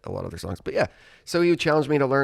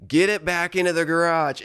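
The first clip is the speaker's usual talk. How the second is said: higher, louder imitation of a mom; mom giving orders; 7 semitones higher, 6 dB louder than usual. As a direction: playfully mimic a mother's firm order. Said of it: "my mom would be like".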